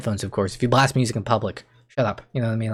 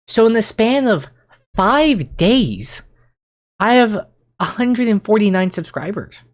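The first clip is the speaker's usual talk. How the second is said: high, animated and thrilled; excited; 6 semitones higher than usual. Real, not acted. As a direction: gush about the numbers. Excited about subscriber growth.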